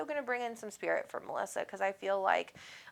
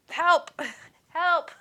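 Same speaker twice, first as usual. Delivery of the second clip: mock call for help; 9 semitones higher, 4 dB louder than usual. Mock plea to absent husband.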